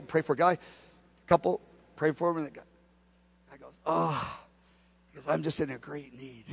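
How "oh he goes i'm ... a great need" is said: very quiet, low mumbled plea; downcast, needy. Retold or imitated. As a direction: voice a needy man softly. Retelling a man in need.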